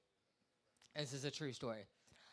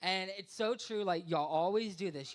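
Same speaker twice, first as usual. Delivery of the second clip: ordinary talk, nothing marked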